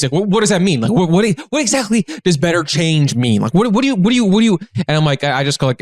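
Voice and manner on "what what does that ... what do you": imitating pushy, stammered questioning; mildly mock in-your-face interrogation; confrontational. Stranger demanding what "better change" means, confrontational. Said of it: "getting in my face".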